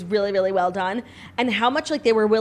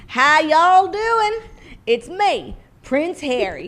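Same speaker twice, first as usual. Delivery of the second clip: higher and slow, hammed-up Texan; fake American drawl; 7 semitones higher, 5 dB louder, much slower than usual. Harry shows off his new American accent, slow fake Texan drawl. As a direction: Prince Harry doing a cowboy howdy. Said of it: "losing your British accent".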